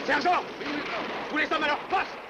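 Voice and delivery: high barked orders to the troops; drill sergeant. Acted. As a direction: call the men to their posts, high and sharp. Sergeant rallies men to posts.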